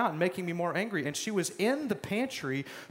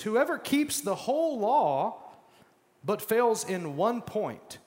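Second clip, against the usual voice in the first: scripture reading; 5 semitones higher, slower than usual. Acted.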